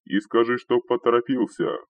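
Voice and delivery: loud, high-pitched call; shouting after someone. A narrator's reading. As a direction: call out to someone running away. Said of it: "крикнул ему вдогонку первый".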